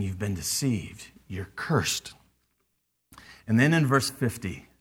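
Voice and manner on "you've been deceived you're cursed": much lower and softer, cold condemnation; hushed verdict. Acted. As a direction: drop it low and quiet like a sentence passed.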